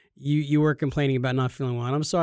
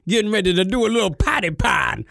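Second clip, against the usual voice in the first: deep soul singer impression; 2 semitones higher, 4 dB louder than usual. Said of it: "You were like".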